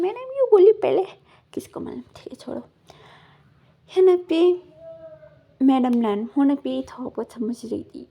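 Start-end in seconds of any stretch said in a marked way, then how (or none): 1.5-2.6 soft whispered brush-off; whispered aside. Retold or imitated. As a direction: whisper it, waving it away. Character dismisses it under breath. (फुसफुसाकर टालना)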